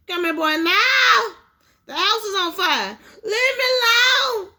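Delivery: high, loud shouted commands and refusals; father and son yelling at the chimney; shouting. Acted. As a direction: yell it across the struggle.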